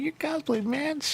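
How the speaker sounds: excited fan. Retold or imitated. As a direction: gush like a starstruck fan. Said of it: "I'd be like".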